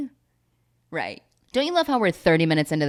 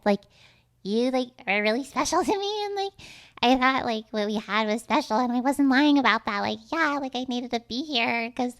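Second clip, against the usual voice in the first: higher, halting confession voice; pitched-up mock-sincere rambling; mock earnest girlfriend; 5 semitones higher than usual. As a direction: act out a gushing, like-filled heartfelt plea. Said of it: "Why are you talking to me like that".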